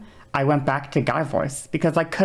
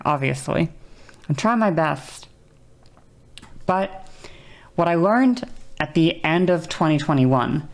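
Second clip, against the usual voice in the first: usual speaking voice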